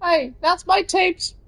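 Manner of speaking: very high cartoon voice for a toy; squeaky toy voice; squeaky character voice. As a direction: pitch it way up like a little critter.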